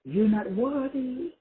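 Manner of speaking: slow, high and soft like a nagging thought; whisper-like doubt, slowly; the little voice in your head, slow and high; inner doubting voice. Retold or imitated. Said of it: "little voice in your head says".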